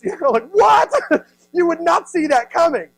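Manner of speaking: laughing at the absurd picture of cousins robbing him. Real, not acted. Pastor laughing to the congregation at his own joke.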